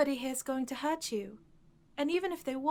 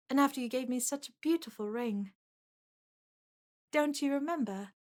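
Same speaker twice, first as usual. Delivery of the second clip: ordinary talk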